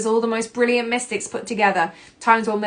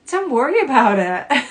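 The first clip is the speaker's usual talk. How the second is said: bright, dismissive brush-off; 4 semitones higher, 4 dB louder than usual. Shown as a demonstration. A grown-up brushing off a child's worry, dismissive. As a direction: wave it away like it's nothing. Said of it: "But not".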